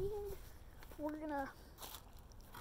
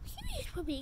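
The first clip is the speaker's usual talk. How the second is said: high coo; 8 semitones higher, 4 dB quieter than usual. Real, not acted. Fawning over the puppy, high baby talk.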